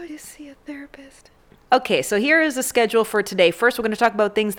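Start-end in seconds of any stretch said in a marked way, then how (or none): 0.1-1.3 unvoiced whispered aside; breathy stage whisper to the camera. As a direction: whisper it like a secret.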